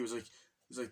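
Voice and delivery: normal talking voice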